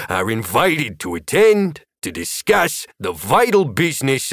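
put-on pompous announcement in a New Jersey accent. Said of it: "in a thick New Jersey accent said".

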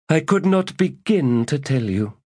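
high, exasperated reply; weary priestess. Acted. Priestess answering the lady, exasperated and high. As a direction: give up on him aloud.

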